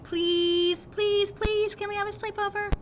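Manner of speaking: put-on kids pleading, pitched high; begging. Two best friends beg their parents for a sleepover, high pleading. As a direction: beg like two eight-year-olds who won't go home. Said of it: "We were like".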